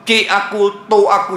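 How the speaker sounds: hammed-up grieving wail; imitating loud wailing. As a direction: act out the forbidden loud crying, lightly. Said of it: "jangan nangis apa mengangkat suara".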